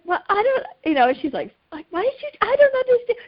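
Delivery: high, puzzled protest; a lightly voiced confused woman asking why; baffled woman. Woman asking Tony Robbins why he pours water, high and baffled.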